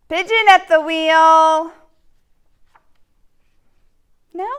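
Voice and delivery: loud, whiny pigeon begging; the pigeon begs and pesters the reader; pleading pigeon. Acted. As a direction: wheedle like a pushy little bird.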